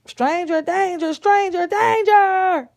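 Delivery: high, loud chant building to a drawn-out yell; full-volume alarm cry for the child to copy; shouted alarm. Shown as a demonstration. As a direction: shout the warning for help, stretching the repeat. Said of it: "Say it".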